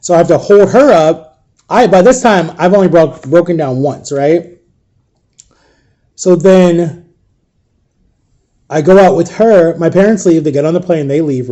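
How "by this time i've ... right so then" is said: ordinary delivery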